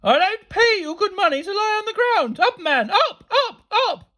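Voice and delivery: very high, loud headmistress tirade; imperious screeched telling-off; shouted scolding. A narrator's reading. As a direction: order him up in furious high shouts. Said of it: "Missus Stade poked her head out and shouted".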